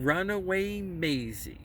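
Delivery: ordinary delivery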